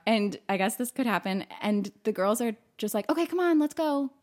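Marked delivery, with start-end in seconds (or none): 3.1-4.1 tag-along friends. Retold or imitated. Acted friends urging each other away.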